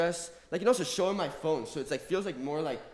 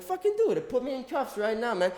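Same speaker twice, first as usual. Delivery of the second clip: defiant yell; 9 semitones higher than usual. Retold or imitated. Detainee daring the cops to cuff him, yelling. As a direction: yell it back, daring them.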